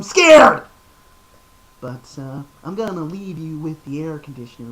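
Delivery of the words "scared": much higher, much louder jump-scare shout; sudden scream. Pretend. Ending the creepy voice with a jump-scare shout. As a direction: burst out to scare them. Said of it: "the creepiest voice I can do".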